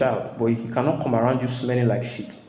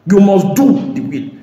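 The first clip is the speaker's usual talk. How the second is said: higher, louder, slow command; emphatic preaching; 8 semitones higher, 10 dB louder, slower than usual. Real, not acted. Preacher insisting to the congregation on obedience. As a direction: hammer home the demand.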